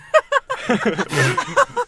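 high-pitched giggling fit; laughter. Real, not acted. Storyteller losing it while voicing Shiva, high laughter. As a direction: break up laughing mid-story. Storyteller breaks up mid-quote.

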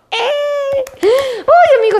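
high-pitched gleeful squeal; excited. Real, not acted. Host thrilled to be connected with followers, excited squeal. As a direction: let out a high, delighted cheer. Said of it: "estoy bien emocionada de estar conectada".

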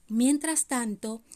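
ordinary delivery, nothing marked

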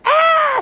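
very high, loud scream of fright; frightened scream. A narrator's reading. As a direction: shriek as the plane drops. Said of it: "Sam screamed".

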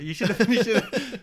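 real laughter while nudging a friend to finish his sentence; laughing. Real, not acted. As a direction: tease him while cracking up. Teasing friend over unfinished sentence.

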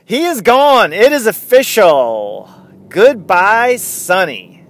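loud, high send-off; triumphant announcement. Real, not acted. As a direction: proclaim it big, like calling out a farewell. Triumphant farewell to departed player.

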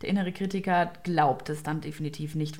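ordinary talk, nothing marked

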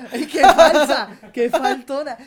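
laughing mock scolding. Real, not acted. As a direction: cackle then tease through laughter. Teasing laugh at friend.

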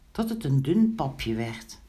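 ordinary delivery, nothing marked

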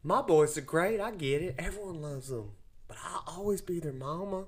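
lightly voiced doting mom; a hint of a proud mama. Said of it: "I just did your mom's voice".

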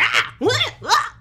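high-pitched short squealed outbursts; little happy spurts. Retold or imitated. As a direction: mimic sudden bursts of happy noise. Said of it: "these little spurts of".

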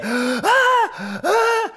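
loud, very high mock shrieks; gasping, high-pitched screaming imitation; imitated toddler scream. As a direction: shriek like a terrified two-year-old. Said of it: "He starts screaming again".

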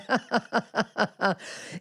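high, braying villain laugh; crazed laugh. A narrator's reading. Platón locks the prisoners in, crazed gleeful laughter. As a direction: laugh as if out of your mind. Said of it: "smál jako smyslů zbavený".

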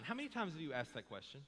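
usual speaking voice

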